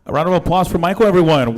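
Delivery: loud and rising, calling the crowd; rally the room to clap; loud announcement. Real, not acted. Host calling for applause.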